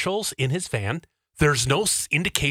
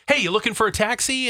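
retold taxi hail; 8 semitones higher than usual. Driver hails the teller.

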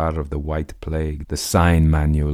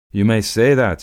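higher, louder wild exclamation; wild laughing cry; 6 semitones higher, 7 dB louder than usual. Acted. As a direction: fling it back with a wild laugh. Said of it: "he cried with a wild laugh".

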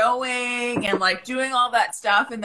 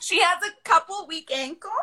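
put-on valley girl; 7 semitones higher than usual. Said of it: "in a Valley Girl voice".

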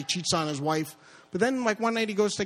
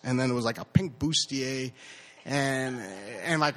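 ordinary talk

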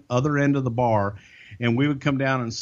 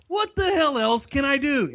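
mock exasperated boss; 13 semitones higher than usual. Vince's retort, voiced high.

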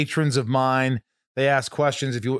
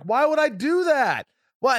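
retold exasperation, pitched way up; exasperated protest; 11 semitones higher than usual. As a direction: drag out an incredulous complaint. Acted protest in story.

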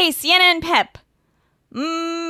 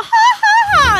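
mock laugh; 7 dB louder, slower than usual. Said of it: "you're all".

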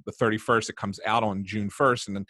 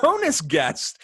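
excited; 12 semitones higher, 4 dB louder, much slower than usual. Real, not acted. Echoing the hype.

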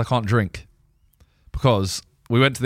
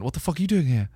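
a loose imitation of an Australian accent, brief and surprised; imitating his accent; 4 semitones higher, 4 dB quieter than usual. Friend bumping into him on the stairs, mock Australian accent. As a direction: greet him in a rough, half-done Australian accent. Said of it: "I don't know what the accent was".

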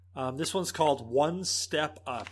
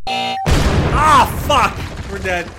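loud high-pitched frustrated shout; frustrated yell; 9 semitones higher, 12 dB louder, much slower than usual. Real, not acted. Bomb explodes.

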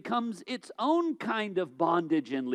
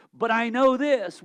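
usual speaking voice

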